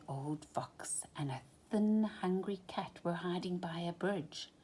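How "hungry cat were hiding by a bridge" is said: ordinary delivery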